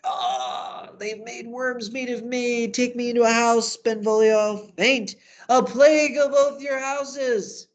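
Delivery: high, loud, wailing death speech; hammed-up dying curse, drawn out at the end; tragic dying Mercutio. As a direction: cry out theatrically as if mortally wounded. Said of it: "all of a sudden it gets tragic".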